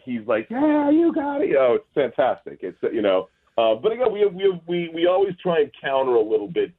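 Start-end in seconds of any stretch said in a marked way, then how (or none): 0.5-1.5 much higher, slow, sing-song taunt; mock gotcha voice. Homeowner with paintball gun taunting sign thieves, mock sing-song. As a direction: tease them like you've already caught them. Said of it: "And he's like".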